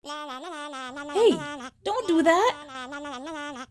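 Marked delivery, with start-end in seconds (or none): none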